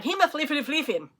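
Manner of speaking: "hemofliffin" garbled into a tangle of f and l sounds; mangled pronunciation. Shown as a demonstration. Southern knitter mocks her own try at a Danish word, "hemofliffin" babbled. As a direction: stumble through "hemofliffin" as mock tongue-tied nonsense. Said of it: "that I sound like".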